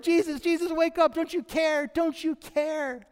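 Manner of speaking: panicked disciples. Retold or imitated. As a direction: wake him up in terror. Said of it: "are freaking out".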